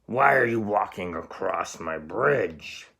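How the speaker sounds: slow, loud troll voice; troll yelling at the goat. Acted. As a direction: yell it slowly like a grumpy troll. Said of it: "yelled the hideous, horrible".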